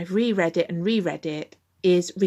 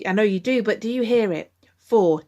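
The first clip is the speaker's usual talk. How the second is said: ordinary talk, nothing marked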